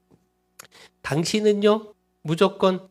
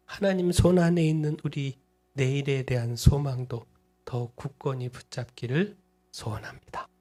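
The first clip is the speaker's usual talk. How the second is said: ordinary talk, nothing marked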